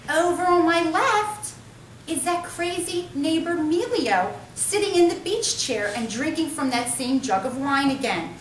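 high, slow, dainty mockery; squeaky prissy homeowner; high-pitched voice. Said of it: "in a high-pitched voice".

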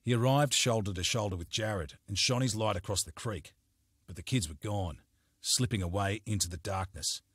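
ordinary delivery

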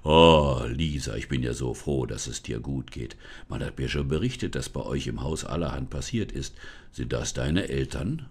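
put-on low, relieved forest spirit greeting the girl; deep forest spirit, relieved to see Lisa. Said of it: "es ertönte die Stimme des Waldgeistes".

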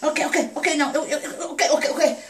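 ordinary talk, nothing marked

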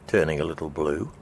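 ordinary delivery

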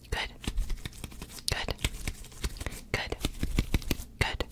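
ordinary talk

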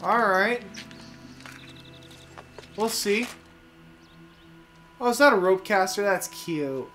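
ordinary talk

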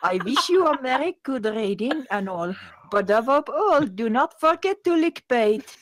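put-on French accent. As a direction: build a hammed-up French accent to a big finish. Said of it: "excellent French accent".

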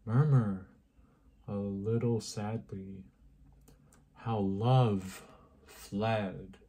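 slow, measured poem recital with long pauses; poetry reading. Acted. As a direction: recite it slowly, phrase by phrase. Reads a Yeats poem aloud.

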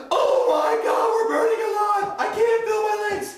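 very high, loud screaming; burning scream. Acted. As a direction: scream like you're on fire. Car on fire.

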